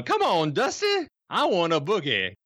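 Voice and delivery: high-pitched mock female call from off screen; imitated lady's voice. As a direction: imitate a lady coaxing her man out to dance.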